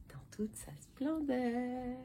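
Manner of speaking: slow hushed whisper of a promise. Acted. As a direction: breathe it out like a secret. Phrase repeated as teasing reveal.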